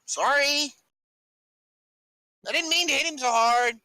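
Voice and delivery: high-pitched, apologetic character voice; sheepish apology. Acted. Fighter apologizing after knocking a man out, high-pitched and sheepish. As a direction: raise the pitch for a contrite sorry.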